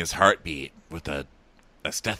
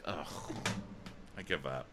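tiny, lower, barely audible voice; mock defeated; 5 semitones lower, 18 dB quieter than usual. Co-host voicing a rival losing out, mock defeated and very quiet. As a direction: fade away as if beaten.

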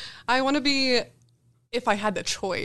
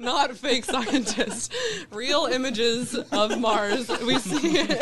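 laughing; 4 semitones higher, slower than usual. Real, not acted. Correcting while laughing.

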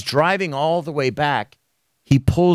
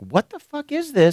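much higher, startled disbelief; mock alarm; 12 semitones higher than usual. The host retelling his alarm at the threatening comments, high disbelief. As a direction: react as if spooked. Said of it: "And I'm like".

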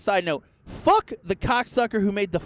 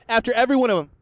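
ordinary talk, nothing marked

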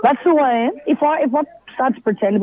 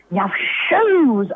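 imitating excited fan; 3 semitones higher than usual. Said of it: "some random woman just comes up and goes".

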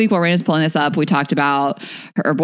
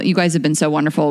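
ordinary delivery

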